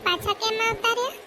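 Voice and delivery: ordinary talk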